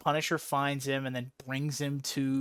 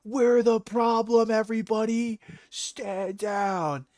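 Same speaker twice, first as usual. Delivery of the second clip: slow, higher, projected command; mock heroic Captain America; 5 semitones higher, slower than usual. Captain America surrendering to first responders, mock heroic call. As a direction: call it out like a solemn hero.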